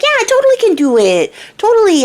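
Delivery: put-on the little man boasts to the crying girl, high and cocky. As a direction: brag like a tiny show-off imp. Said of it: "And he's like".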